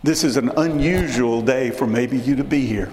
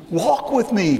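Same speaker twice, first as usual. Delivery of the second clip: a hint of God calling, higher; 8 semitones higher than usual. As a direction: call gently upward as God. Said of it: "God says".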